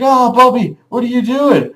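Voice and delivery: loud, high-pitched mock panic; hammed-up distressed plea; alarmed protest. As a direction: cry out as if pinned to the wall. Pinned dreamer protests.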